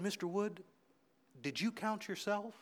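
quiet question. Retold or imitated. Sergeant quietly asking the cadet: very soft voice.

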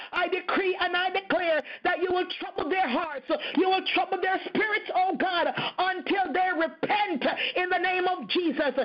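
decree builds into a tearful shout, fast and high; crying decree. Real, not acted. Preacher decreeing over unrepentant leaders, building to a tearful shout.

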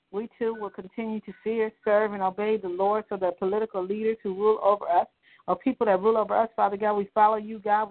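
usual speaking voice

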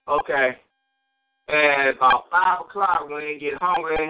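a hint of a low plotting devil voice; the devil plotting against a hungry driver, lightly voiced; scheming devil. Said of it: "So he's sitting up there observing".

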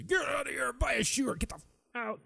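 high-pitched mock yell at a customer; rude clerk outburst. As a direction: imitate a clerk ordering a customer out. Rude store clerk voiced.

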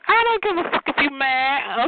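high-pitched sassy retort; high, dismissive comeback; defiant clapback. Retold or imitated. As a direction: throw it back in a high, defiant squeal. Retold clapback in a quarrel.

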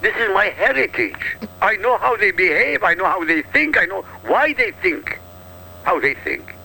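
ordinary talk

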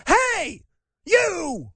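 high-pitched mock holler; mock calling out. Host acts reporter confronting the candidate, mock shout. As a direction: holler it as if heckling someone.

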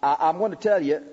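normal talking voice

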